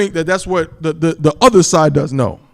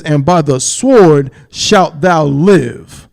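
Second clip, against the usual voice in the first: "sword" drawn out, very slow and weighty; slow stressed verse; 3 dB louder, much slower than usual. Shown as a demonstration. Preacher rereading the verse to his congregation, very slow and weighty. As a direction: lean hard on "sword", very slowly. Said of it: "It says".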